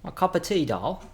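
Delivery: hammed-up English accent, high start; cringey posh English put-on. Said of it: "we're done with the cringe".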